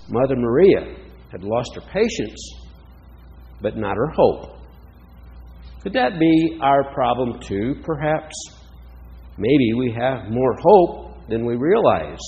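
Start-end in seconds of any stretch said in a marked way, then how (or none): none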